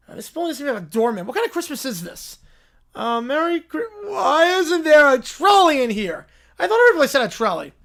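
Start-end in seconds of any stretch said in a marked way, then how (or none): 3.0-7.7 put-on whiny kid. Spoiled kid at the door demanding a trolley, loud whine. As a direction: whine it out, pouting. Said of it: "the Whiny Quintet".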